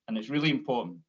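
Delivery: normal talking voice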